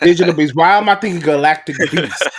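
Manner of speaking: amused at his own slip-up; laughing at himself. Real, not acted. Laughing at own name mix-up.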